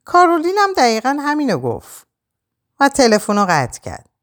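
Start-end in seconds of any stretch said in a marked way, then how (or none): 0.0-2.1 mildly astonished, pitched high; a high note of surprise; surprised. A narrator's reading. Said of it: "پدرش با تعجب گفت".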